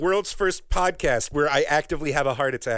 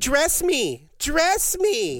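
high-pitched pretend childlike Vocaloid; mock creepy child; 9 semitones higher, much slower than usual. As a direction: beg in a high, put-on doll voice. Voices the childlike Vocaloid twins.

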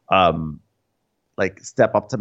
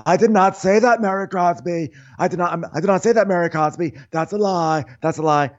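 indignant denial; 8 semitones higher than usual. Retold or imitated. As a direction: hammer the denial at Mary. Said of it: "Lisa goes".

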